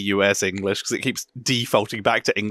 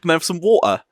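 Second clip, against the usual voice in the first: put-on London chav accent; 9 semitones higher than usual. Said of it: "the London style voice".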